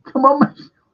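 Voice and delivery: high, loud mock call; mimicking Colin. Retelling, mimicking Colin calling out. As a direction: shout it like Colin egging him on. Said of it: "Colin's like".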